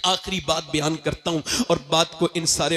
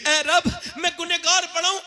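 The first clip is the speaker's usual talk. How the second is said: high, crying prayer of a sinner; weeping plea; 10 semitones higher than usual. A narrator's reading. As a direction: cry out to God, voice climbing. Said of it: "ऐसा रोया".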